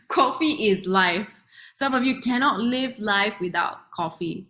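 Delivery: normal talking voice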